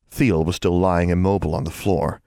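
usual speaking voice

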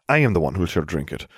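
ordinary delivery, nothing marked

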